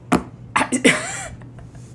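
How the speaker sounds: high breathy giddy giggle; giddy laugh. Real, not acted. Telling viewers about being called he, a giddy giggle. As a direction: let a giddy squeal of a laugh slip out. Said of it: "I just get so giddy".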